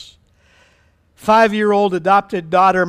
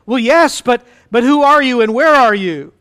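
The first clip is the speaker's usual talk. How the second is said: raised, louder questioning voice; puzzled calling; 4 semitones higher, 7 dB louder than usual. Acted. Jack asking the unseen voice who it is, puzzled calling. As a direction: call up in mild bewilderment.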